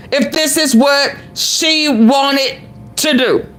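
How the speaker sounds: normal talking voice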